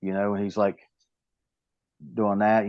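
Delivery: ordinary talk, nothing marked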